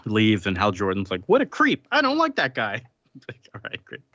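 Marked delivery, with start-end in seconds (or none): 1.2-2.8 much higher-pitched, affronted mimicry; mock indignation. Retelling Hal Jordan's reaction to Batman, high-pitched mock indignation. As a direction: squeak it out like an offended hero.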